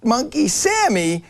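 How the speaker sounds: high indignant rant. Acted. Host ranting, high pitched.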